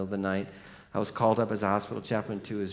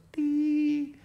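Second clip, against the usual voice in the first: high monitor beep held long; flatline whine. Retold or imitated. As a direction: imitate a heart monitor flatline. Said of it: "the little whine goes".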